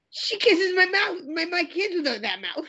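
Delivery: high, outraged mobster voice; Robert De Niro. Acted. Mob boss to psychiatrist about his wife, outraged. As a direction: protest like a scandalised mob boss, slowly at first. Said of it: "talk to Robert De Niro".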